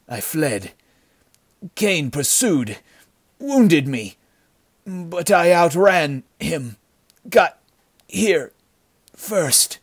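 high, strained, slow broken phrases; dying gasps. A narrator's reading. Dying bandit telling of his flight, gasping. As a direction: struggle out each word with failing strength. Dying man's last account.

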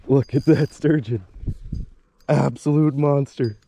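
loud, thrilled exclamations; real awe at a huge catch; excited shouting. Real, not acted. Landing a giant sturgeon.

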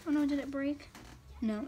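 ordinary talk, nothing marked

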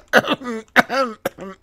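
real cough from choking on saliva; sudden cough, saliva down the wrong way. Real, not acted. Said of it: "se me atraganta la saliva". (tos por atragantarse con la saliva)

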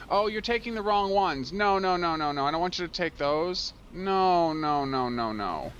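high, loud protest, falling away by the end; exasperated protest. Real, not acted. Player scolding the game for wrong pickups, exasperated. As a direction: cry out in dismay, then let it sink.

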